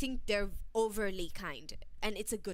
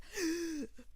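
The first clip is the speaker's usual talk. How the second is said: gasp; 7 semitones higher than usual. Retold or imitated. Panicked retelling of feeling rushed.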